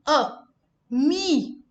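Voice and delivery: ordinary delivery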